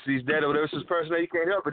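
ordinary talk, nothing marked

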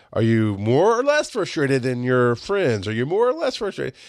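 silly, exaggerated quizzing; playfully silly. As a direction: needle a friend with mock-serious questioning, swooping up in pitch. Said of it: "I was kind of being silly".

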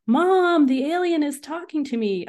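high, loud shout to mom inside; calling out loudly for mom. A narrator's reading. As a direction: yell it across the house. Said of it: "I shouted to my mom".

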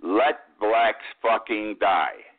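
turning the quote around, slowly and pointedly; slow, deliberate quote. Retold or imitated. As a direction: Let blacks fucking die said very slowly, word by word. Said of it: "And so the statement would be".

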